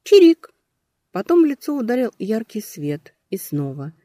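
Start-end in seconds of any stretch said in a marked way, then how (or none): 0.0-0.5 squeaky chirp. Acted. Alarm bird keeps chirping.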